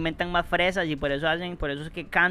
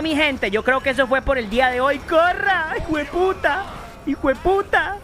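frantic high yelling; 8 semitones higher than usual. Real, not acted. Gamer trapped at a locked exit, yelling in panic. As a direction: shout like the exit won't open and they're coming.

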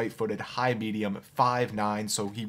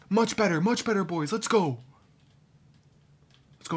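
normal talking voice

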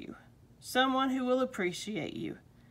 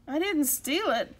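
higher-pitched, indignant denial; understated protest, pitched up; 6 semitones higher than usual. A narrator's reading. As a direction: protest your innocence. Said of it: "protested the old gentleman".

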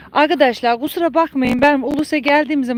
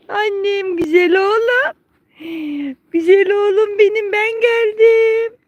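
affectionate cooing; 10 semitones higher than usual. Real, not acted. Owner greeting her beloved donkey, high doting coo.

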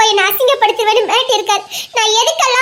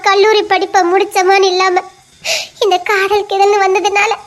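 ordinary talk

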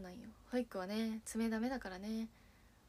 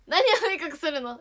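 louder and higher, half-laughing alarm; alarmed loud question; 6 semitones higher, 12 dB louder than usual. Real, not acted. Idol demanding what a co-member will snitch, loud and alarmed. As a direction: demand to know what she'll tell on.

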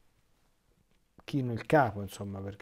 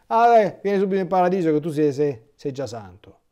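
brightly, much higher and louder, rushing through, mocking how easy it sounds; mock breezy invitation; 3 semitones higher, 8 dB louder than usual. Priest mocking the idea of walking straight into heaven, higher, louder and breezy. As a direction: ham up a cheery "come straight to heaven". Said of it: "E pensare che dice".

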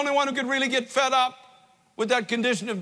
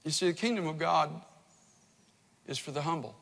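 much lower, much softer closing words; hushed; 8 semitones lower, 7 dB quieter than usual. Real, not acted. Preacher closing the sermon to the church, hushed and low. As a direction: drop almost to a murmur for the humble.

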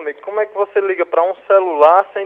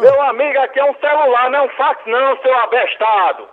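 higher, louder furious telling-off; angry shout; 5 semitones higher, 5 dB louder than usual. Real, not acted. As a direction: shout at the caller in anger. Shouting at prank caller.

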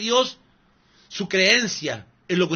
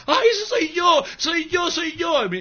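high, shouted mock cry of an eager believer; mock eager viewer; 12 semitones higher, slower than usual. Preacher mocking a viewer who claims the prophecy, high mock shout. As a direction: yell it out like a desperate fan.

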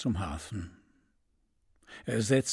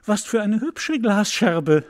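put-on higher, louder happy girl; the little girl cries out in joy; delighted cry; 7 semitones higher, 6 dB louder than usual. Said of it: "rief die Kleine". (freudig und höher ausrufend)

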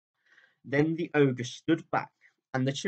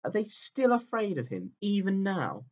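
tearful question; 8 semitones higher than usual. A narrator's reading. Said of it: "sobbed Susan".

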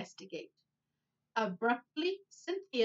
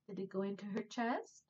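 ordinary delivery

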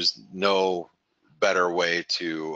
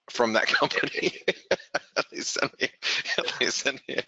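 words squeezed out through real giggles; helpless real laughter between the words; laughing; 6 semitones higher than usual. Real, not acted. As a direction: break up laughing mid-sentence. Amused by catalogs still arriving.

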